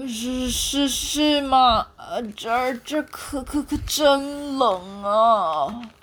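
slow, stammering shiver. Acted. Freezing monkey stammering to the penguin, shivering. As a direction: stutter from the cold. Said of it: "冷得全身发抖".

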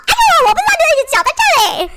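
high, fast fake-innocent oops; mock innocent apology. Elbowing a rude neighbour, high mock-innocent apology. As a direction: elbow them, then sound sorry. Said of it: "就说".

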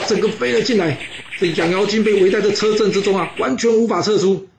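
excited battle narration. A narrator's reading. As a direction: tell the encirclement breathlessly, rising high. Narrator builds battle climax.

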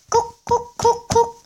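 high mouth knock-knock; knocking sound. Pretend. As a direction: imitate knocking on a cave door. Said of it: "悟空敲了敲洞穴". (用嘴模仿敲门声)

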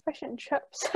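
breathy whisper. Real, not acted. Repeating the phrase to herself, whispered.

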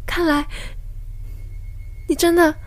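surprised. Acted. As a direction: gasp in realization. Amazed discovery.

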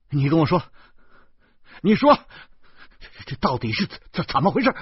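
提高嗓门急着追问; 一把拽起他大声逼问; 急切逼问. A narrator's reading. 逼问方志. (拽着弟弟急吼吼地追问)

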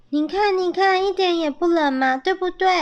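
high, chirpy persuading; eager coaxing. A narrator's reading. Young squirrels persuading dad it isn't cold, eager.

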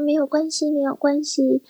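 high, sweet celebrity whimper over a pulled hair; Lin Chi-ling voice. Retold or imitated. Imitating Lin Chi-ling hurt by a hairdresser, high sweet voice. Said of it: "如果林志玲讲话这个样子".

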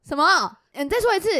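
put-on a girl who can't hear her friend, loud and annoyed; irritated loud demand. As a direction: snap back, annoyed.

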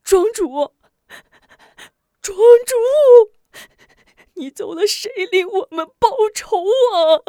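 high, loud grief-stricken cry. Acted. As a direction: cry out over the body. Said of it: "大哭起来".

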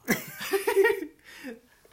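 high burst of laughter; loud high laugh. Real, not acted. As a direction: crack up remembering it. Laughs at own reply. (大声笑出来)

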